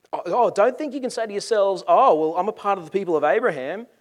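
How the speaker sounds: mock their excuse loudly; scoffing rebuke. John scoffing at leaders' protests.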